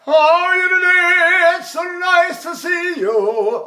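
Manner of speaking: high slow sung speaking voice; high operatic speech. Shown as a demonstration. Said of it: "So I'm going".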